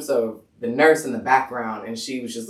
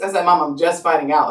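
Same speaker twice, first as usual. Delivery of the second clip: ordinary delivery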